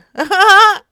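loud laugh bursting out; burst of laughter. Real, not acted. Laughs at own prank.

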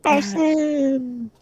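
slow, drawn-out goofy voice; silly voice. Pretend. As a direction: stretch it in a silly voice. Joking odd voice repeat.

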